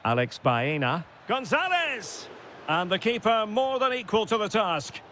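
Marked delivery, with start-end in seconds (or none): 1.3-2.3 higher, drawn-out name call; excited commentator's shout of a name, unforced. Real, not acted. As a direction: stretch the surname out as the shot comes. Commentator calls out a shot.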